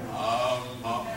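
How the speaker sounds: low droning mock-meditation "um"; drawn-out low meditative hum; meditation humming. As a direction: imitate someone humming in meditation. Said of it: "legs crossed and humming".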